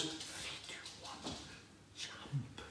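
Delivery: hushed, halting countdown; whisper. Acted. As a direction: whisper it with pauses before the jump. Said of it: "says to the horse".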